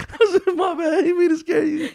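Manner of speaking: high squealing laughter through words; laughing. Retold or imitated. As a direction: crack up mid-story. Laughing retelling.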